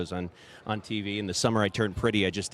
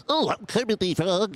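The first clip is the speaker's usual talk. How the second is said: much higher, slow Kermit with the nose pinched; 9 semitones higher than usual. Shown as a demonstration. Demoing Kermit the Frog done with the nose pinched. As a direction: Kermit through a held nose. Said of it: "Kermit the Frog pinching your nose".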